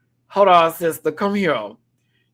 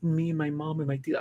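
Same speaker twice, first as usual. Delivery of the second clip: mock emotional sell; 4 semitones higher, 8 dB quieter than usual. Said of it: "how Juicy's trying to sell it".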